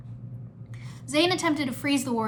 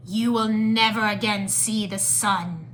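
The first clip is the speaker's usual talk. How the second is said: slow, loud, menacing villain; declaring Skull Sorcerer; 5 dB louder, slower than usual. A narrator's reading. Skull Sorcerer sentences the captured ninja, menacing declaration. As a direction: pronounce doom deliberately. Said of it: "the Skull Sorcerer declared".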